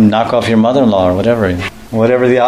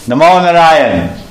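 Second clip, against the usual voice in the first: slow, loud, drawn-out filmy hail; Hindi movie devotee; 4 semitones higher, 4 dB louder, slower than usual. Pretend. Gopis hailing Narayan like a Hindi movie, loud and slow. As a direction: ham it up like a Hindi film prayer. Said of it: "This looks like some Hindi movie".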